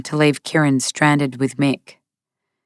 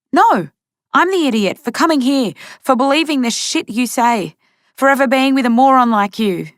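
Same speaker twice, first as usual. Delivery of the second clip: bitter outburst; 8 semitones higher than usual. A narrator's reading. Zoe lashes out at Kieran before leaving, high bitter tirade. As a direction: spit it out at him.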